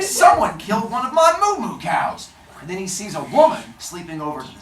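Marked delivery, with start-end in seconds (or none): 0.0-2.3 hammed-up enraged outburst; loud, high-pitched childish outrage; Apollo. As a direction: wail indignantly over a beloved pet. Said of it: "Apollo is enraged".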